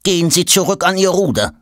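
high, commanding servant order; stern command. Acted. Servant sternly ordering Tom back to rowing. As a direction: send him back to the oar. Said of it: "befiehlt er streng".

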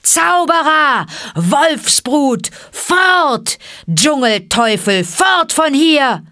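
high, drawn-out angry crowd shouts; screaming mob. Acted. Villagers stoning Mowgli out of the village, screaming. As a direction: yell it loud to drive an outcast away. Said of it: "und die Menge schrie".

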